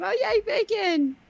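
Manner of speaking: put-on excited kid. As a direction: recall a kid's delight in a high voice. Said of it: "remember being a kid going".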